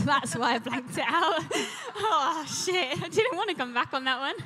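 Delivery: laughing high and loud through the words; embarrassed laughing. Real, not acted. Guest recalling a cringe audition, laughing loud. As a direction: laugh it off, squirming.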